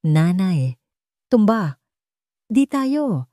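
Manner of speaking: put-on a frightened little kid crying into the phone; child caught between sobs; sobbing child. A narrator's reading. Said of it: "boses ng bata humihikbi".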